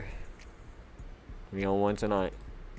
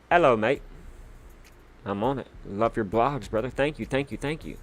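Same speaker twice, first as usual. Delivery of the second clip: ordinary talk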